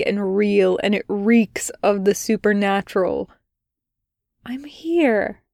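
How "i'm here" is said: welcoming. A narrator's reading. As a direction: whisper it gently, inviting him in. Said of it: "her tone welcoming".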